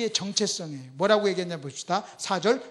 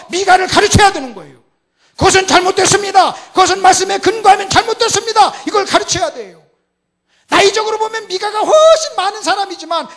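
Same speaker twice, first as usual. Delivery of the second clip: high, loud, impassioned sermon cry; thundering pulpit delivery; full-force preaching; 9 semitones higher, 14 dB louder than usual. Real, not acted. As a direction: drive the point home to the congregation at full volume.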